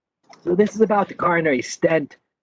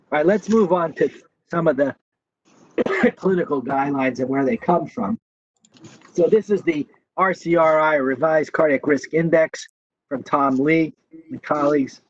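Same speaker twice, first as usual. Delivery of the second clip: usual speaking voice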